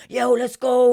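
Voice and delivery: high, loud hype yell; acted rallying shout; screaming encouragement. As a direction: yell it like you're firing up the crew. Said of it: "screaming at people".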